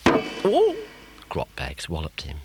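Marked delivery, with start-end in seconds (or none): 0.1-0.8 high, loud witch shriek; outraged yelp. Acted. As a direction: shriek in annoyance.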